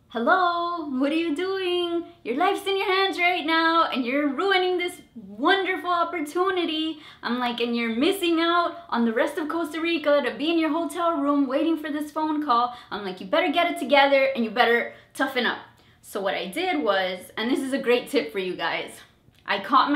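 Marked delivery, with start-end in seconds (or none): 0.1-7.0 high, exasperated self-scolding; scolding herself. Retold or imitated. To herself mid-interview, high and exasperated. 7.6-12.7 high, exasperated self-scolding; scolding herself. Retold or imitated. To herself mid-interview, high and exasperated. 13.2-15.6 fast, firm self-warning; stern self-talk. Retold or imitated. To herself during the interview, fast and stern.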